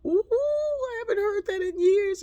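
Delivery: high, loud delighted ooh; a loud, delighted exclamation from the crowd; audience reacting. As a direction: voice the crowd's shock of recognition. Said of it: "I can feel some of you going".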